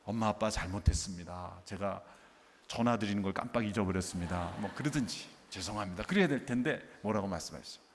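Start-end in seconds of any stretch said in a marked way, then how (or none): 0.0-4.5 low, lightly acted child's apology; 공손히 사과하는 아이. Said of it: "뭐라고 대답했어야 됩니까". 5.5-6.0 low, lightly acted child's apology; 공손히 사과하는 아이. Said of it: "뭐라고 대답했어야 됩니까".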